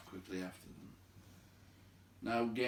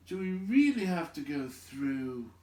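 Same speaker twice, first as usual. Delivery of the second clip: groaning; 6 semitones higher, 5 dB louder than usual. A narrator's reading. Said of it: "groaned the Hobbit".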